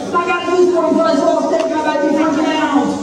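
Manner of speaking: hammed-up fretting over the snake; mock panic. Preacher playing someone flustered by a snake, mock panic.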